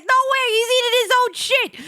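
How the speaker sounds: high, loud mock disbelief; retold cracking-up disbelief. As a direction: squeal it, acting thrilled and appalled. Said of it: "I started cracking up".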